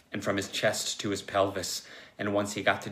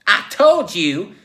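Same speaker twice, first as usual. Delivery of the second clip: high, loud, drawn-out outburst; exclaiming; 12 semitones higher, 14 dB louder, slower than usual. Acted. Joe throws it at the therapist, exclaiming. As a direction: shout it slowly in vindication. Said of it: "Joe exclaimed".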